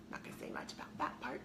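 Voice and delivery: quick guilty whisper. Real, not acted. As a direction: slip it in as a sheepish aside. Guilty aside about fast food.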